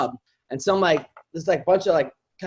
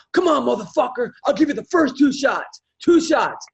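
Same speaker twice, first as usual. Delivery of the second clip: taunting fighter; 7 semitones higher than usual. Retold or imitated. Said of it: "puts his hands down and goes".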